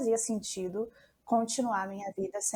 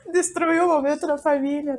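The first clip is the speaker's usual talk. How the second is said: laughing; 7 semitones higher, 10 dB louder than usual. Real, not acted. Laughing at own outburst.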